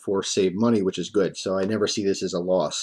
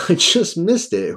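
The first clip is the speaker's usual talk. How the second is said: laughing frustration; 10 semitones higher, 3 dB louder, slower than usual. Retold or imitated. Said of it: "So I'm thinking".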